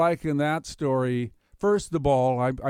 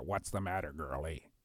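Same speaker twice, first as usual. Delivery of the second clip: frog voice; 7 semitones lower, 10 dB quieter than usual. Acted. Frog speaks to girl.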